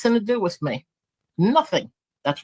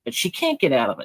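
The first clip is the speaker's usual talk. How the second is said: ordinary talk, nothing marked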